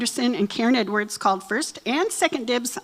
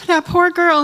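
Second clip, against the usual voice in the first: higher, louder mock pity; pitying audience voice; 7 semitones higher, 8 dB louder than usual. Bride's friends imitated sighing that poor girl, mock pity. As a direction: play the teary listener. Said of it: "you're gonna go".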